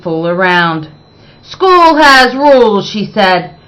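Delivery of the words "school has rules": stern teacher. Acted. Strict teacher quotes rule.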